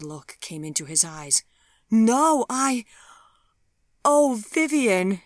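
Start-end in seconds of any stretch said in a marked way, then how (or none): 1.9-5.2 high, loud, desperate scream. Acted. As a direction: scream at him as it breaks apart. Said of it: "she screamed at him".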